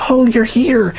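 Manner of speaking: high excited greeting. A narrator's reading. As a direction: burst out happily, pitch jumping high. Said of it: "Obadiah is so excited to see Elijah".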